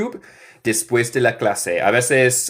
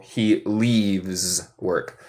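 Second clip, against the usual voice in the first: very slow, stressing leaves; slow model sentence; 3 semitones lower, 3 dB quieter, much slower than usual. Shown as a demonstration. Teacher showing the added S to students, very slow. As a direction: draw out "leaves" with its S. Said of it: "solo agrego S con he y she".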